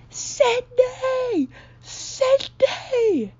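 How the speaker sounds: high-pitched drawn-out holler through the floor; imitating sister's scream. Sister calling down from the floor above, screaming. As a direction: mimic her shrieking call from upstairs. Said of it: "she's screaming my name".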